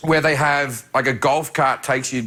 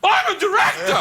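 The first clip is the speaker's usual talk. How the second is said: yelled out. Retold or imitated. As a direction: shout it with full Pacino fever. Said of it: "I just yelled out".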